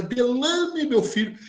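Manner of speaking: name swooping high and drawn out; theatrical astonished call. Retold or imitated. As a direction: imitate her dramatic "Delane!". Imitating the woman's dramatic address. (chamado teatral de espanto)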